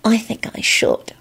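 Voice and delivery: soft unvoiced whisper, slow; breathless whisper. A narrator's reading. Solange leaning toward Quentin, slow breathy whisper. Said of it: "she whispered breathlessly".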